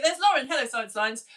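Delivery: normal talking voice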